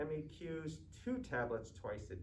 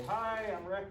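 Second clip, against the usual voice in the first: higher and louder, upbeat hello to a patient; bright bedside greeting; 8 semitones higher, 6 dB louder than usual. Pretend. Student nurse greets his patient, higher and louder and upbeat. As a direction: greet her like a cheery nurse.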